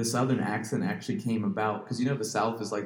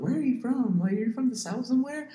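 much higher, puzzled questioning voice; curious stranger; 14 semitones higher than usual. Retold or imitated. People asking him where he is from, high and puzzled. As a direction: play someone squinting at his accent. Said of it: "some people are like".

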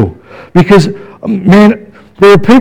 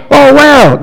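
high, bright-eyed "Oh, wow"; delighted wow; 9 semitones higher than usual. Shown as a demonstration. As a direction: gasp it out with joy. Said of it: "I can't say it again".

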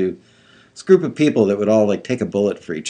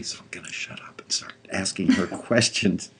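soft whispered aside; whispering; 8 dB quieter than usual. Real, not acted. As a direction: whisper it under your breath.

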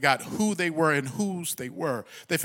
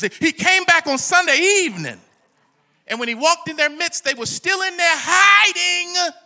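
raised, loud preacher's voice; ringing sermon delivery, higher and louder; full-force preaching; 11 semitones higher, 8 dB louder than usual. Real, not acted. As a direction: preach it out to the congregation.

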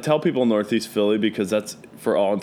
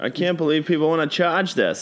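higher-pitched, put-on Southie Boston accent; 7 semitones higher than usual. Host imitating a stressed coworker from Boston, Southie accent. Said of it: "Southy Boston trash accent came out".

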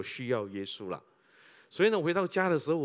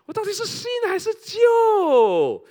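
slow, high, loud shout falling hard on 旧; slow shouted outcry with 旧 hit hard and falling; anguished shout; 12 dB louder, slower than usual. Acted. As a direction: shout 新还是旧 out to the rafters, dragging 旧. Preacher shouts the dilemma.

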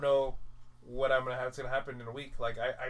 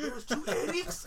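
stretched-out high squeal of disbelief through laughter; laughing disbelief; 12 semitones higher, slower than usual. Real, not acted. Repeating kid's amazement, laughing.

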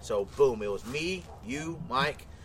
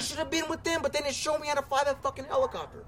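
mock whine pitched up high; jealous whiny quote; 11 semitones higher, 4 dB quieter than usual. Retelling his jealous dream, mock whining up high. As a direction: play the left-behind friend, whining up high. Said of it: "I'm like".

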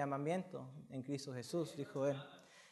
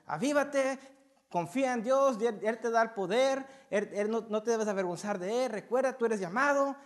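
urgent exhortation; 4 semitones higher, 6 dB louder than usual. Retold or imitated. Pablo urging Timoteo, sermon voice.

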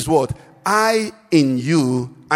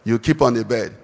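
ordinary talk

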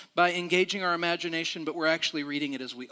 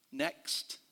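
curt dismissal; 11 semitones higher, 9 dB quieter than usual. Retold or imitated. Airline clerk dismisses them curtly.

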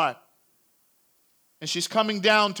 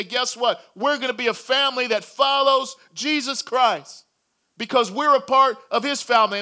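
higher, louder happy news; joyful announcement; 6 semitones higher, 6 dB louder than usual. Acted. Parents telling their kids the great news, excited. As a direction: burst it out to the kids with joy.

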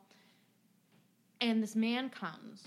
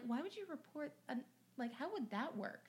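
much softer, puzzled mumble to herself; baffled aside; 5 semitones higher, 10 dB quieter than usual. Retold or imitated. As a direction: murmur it in disbelief. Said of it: "I'm like".

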